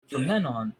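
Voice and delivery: ordinary delivery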